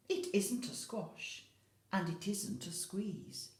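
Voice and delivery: ordinary delivery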